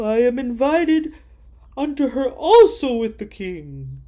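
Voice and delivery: a puffed-up man bragging, then bitter; boastful Haman. Acted. Haman brags to his wife and friends, loud and proud.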